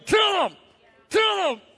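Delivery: high, squeaky goading voice; egging on. Retold or imitated. Your flesh goading you in the meeting, high mock voice. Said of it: "your flesh is going".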